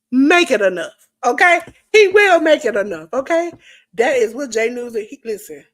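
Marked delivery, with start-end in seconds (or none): 0.1-1.6 emphatic shout. Real, not acted. Hyping up a friend's channel. 1.9-3.5 emphatic shout. Real, not acted. Repeats hype line louder.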